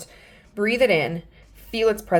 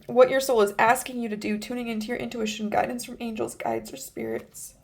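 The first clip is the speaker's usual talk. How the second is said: ordinary talk, nothing marked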